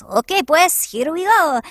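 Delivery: hammed-up Latin accent; Latin Mario. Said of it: "Which is like a Latin Mario".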